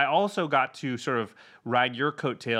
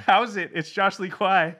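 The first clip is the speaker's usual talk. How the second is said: excited; 7 semitones higher, 5 dB louder than usual. Real, not acted. Hyped host intro.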